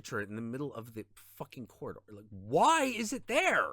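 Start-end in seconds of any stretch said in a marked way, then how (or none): 2.3-3.7 rising, louder, higher-pitched exasperated rant; venting at the useless teammate, getting shriller; angry rant. Real, not acted. Raging at a bad teammate.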